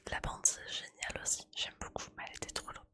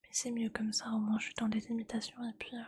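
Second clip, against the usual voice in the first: soft-spoken ASMR voice, gently voiced after the whisper; 13 semitones lower, 6 dB louder than usual. ASMR vlogger switching to soft spoken for viewers, gentle and calm. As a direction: speak softly and calmly, close to the mic. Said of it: "je commence le soft spoken".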